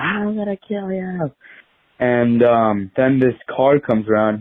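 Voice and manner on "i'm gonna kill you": high, softer mock kid threat; little brother's threat, pitched up and softer. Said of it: "He's like".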